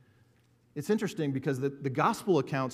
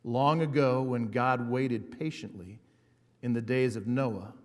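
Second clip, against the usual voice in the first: slow, weighty scripture reading; 6 dB louder than usual. Acted. Pastor reading First Peter to the congregation, slow and weighty. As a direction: let each phrase land slowly.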